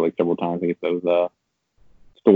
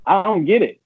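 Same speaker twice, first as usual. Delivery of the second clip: much higher, louder cry of defeat; exasperated outburst; 11 semitones higher, 4 dB louder than usual. Retold or imitated. Player complaining to the game, high and exasperated. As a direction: throw up your hands at the game.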